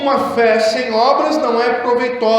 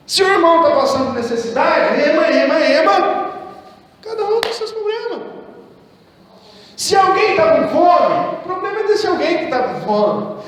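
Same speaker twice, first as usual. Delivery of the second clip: higher-pitched mock brush-off; mock indifference; 6 semitones higher than usual. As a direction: wash your hands of it, mockingly. Voicing an indifferent church member.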